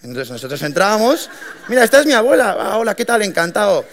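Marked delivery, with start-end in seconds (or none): 1.7-3.8 much higher and louder, gushing welcome; a jolly host showing off grandma; cheery introduction. Retold or imitated. Customer introducing his grandmother to the delivery boys, cheery high gushing.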